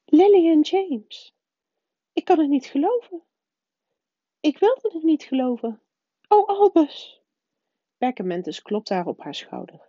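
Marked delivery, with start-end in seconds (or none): 0.0-7.2 the shock of hearing her friends are dead; stricken professor. A narrator's reading. McGonagall gasping in grief.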